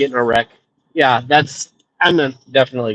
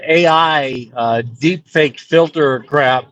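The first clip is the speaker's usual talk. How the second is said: usual speaking voice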